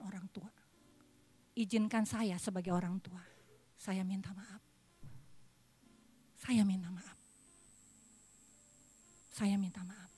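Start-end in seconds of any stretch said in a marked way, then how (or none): 3.7-7.2 soft, low, heartfelt sorry; humble apology from the pulpit; sincere apology. Real, not acted. 9.3-10.1 unvoiced, broken whispered apology; whispered heartfelt apology. Real, not acted.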